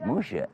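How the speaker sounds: "Musha" with a sh sound; soft sh in the middle of "Musha"; Hebrew pronunciation. Shown as a demonstration. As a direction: say "Musha" with sh, as in shalom. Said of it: "other people call him".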